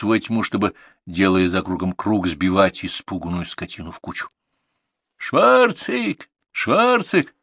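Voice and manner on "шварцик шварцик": high, carrying name calls; calling animals. A narrator's reading. Grandson calls his bullocks from the dark, hollering. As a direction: holler each name across the field. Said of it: "звал Артур".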